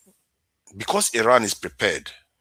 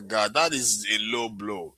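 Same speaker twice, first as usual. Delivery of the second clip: ordinary talk, nothing marked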